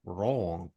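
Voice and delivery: Rouen said low and thick, like peanut butter in the mouth; mouth full of peanut butter. Shown as a demonstration. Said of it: "say it like there's peanut butter in your mouth".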